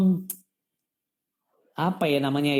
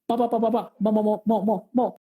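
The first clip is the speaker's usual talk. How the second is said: higher-pitched imitation of a teasing stammer; mocking stutter; 4 semitones higher than usual. Friend phones in to tease the speaker's stammer, mock stuttering. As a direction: stutter on each word in mockery, repeating it over and over. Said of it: "dia mau ngeledek saya".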